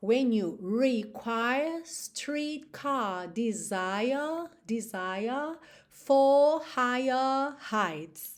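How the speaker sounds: slow sung line, no R after vowels, long vowels; slow British sung lyric; British accent. Shown as a demonstration. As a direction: sing it British, stretch the vowels. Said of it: "Olha quanta diferença".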